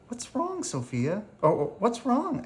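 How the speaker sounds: put-on high, anxious child's question; worried asking. A narrator's reading. Sophia seeing her neighbour cry, asking in a high worried voice. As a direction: fret like a worried little girl. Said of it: "asks Sophia".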